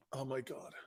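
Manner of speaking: very soft, under the breath; stunned hush. Retold or imitated. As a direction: barely voice it, as if overwhelmed. Acting out his stunned reaction.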